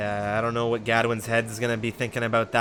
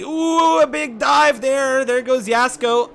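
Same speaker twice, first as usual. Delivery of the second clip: high, loud burst of excitement; excited race call; 10 semitones higher, 8 dB louder, slower than usual. Real, not acted. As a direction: shout the dive as it happens, voice leaping up. Live call of a sudden overtake.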